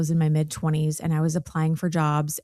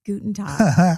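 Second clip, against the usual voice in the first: Guten Tag said louder in a German accent; a touch of German pronunciation on Guten Tag; German greeting; 7 dB louder, slower than usual. Shown as a demonstration. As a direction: show off school German. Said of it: "speak German".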